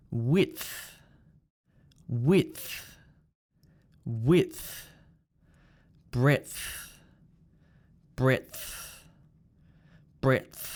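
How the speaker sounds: low "Width", "Breadth" with a stop released into th; DTH ending. Shown as a demonstration. Teacher models for repeating, DTH ending "Width", "Breadth". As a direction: stop the tongue, then push th on "Width", "Breadth". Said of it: "we have D T H".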